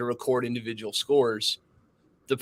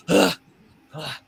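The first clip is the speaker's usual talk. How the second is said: high, sharp mock-panicked gasps; imitating a rattled shooter's yelps; 5 semitones higher than usual. Rattled shooter panicking as the instructor walks past, mock gasps. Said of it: "He's like".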